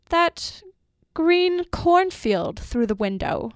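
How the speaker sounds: slow, dreamy wondering. A narrator's reading. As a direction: say it as if drifting into a reverie. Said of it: "he said dreamily".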